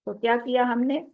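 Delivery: usual speaking voice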